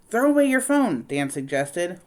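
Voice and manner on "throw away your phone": higher, louder urgent suggestion. Acted. Dan urging Nellie, high and louder. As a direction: push it on her fast.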